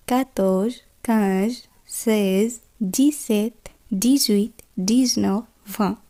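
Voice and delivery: quiet, careful French numbers eleven to twenty; model French pronunciation. Shown as a demonstration. Teacher showing students eleven to twenty, quiet and careful. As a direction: articulate each number softly, one by one. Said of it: "teacher models French numbers".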